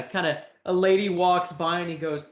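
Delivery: ordinary delivery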